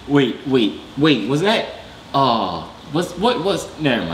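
normal talking voice

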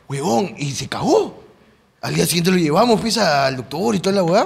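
usual speaking voice

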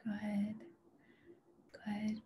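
usual speaking voice

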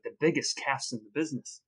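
usual speaking voice

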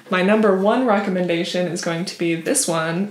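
ordinary talk, nothing marked

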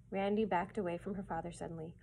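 ordinary delivery

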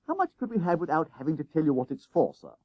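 put-on fast, high boy's wheedling question; undeterred schoolboy. A narrator's reading. Jennings bargains with teacher for money, politely persistent. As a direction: rattle off a polite plea. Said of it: "Jennings was made of sterner stuff".

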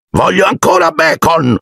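put-on high, whiny demand of a spoiled boy. Dudley demands bacon. (bambino viziato che pretende, voce alta)

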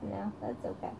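whispered reassurance. Real, not acted. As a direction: breathe it softly to the little one. Soft aside to baby.

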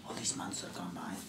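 hushed, breathy whisper of long loneliness; stage whisper. Acted. Last survivor whispering to the stranger. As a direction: whisper it to yourself, haunted.

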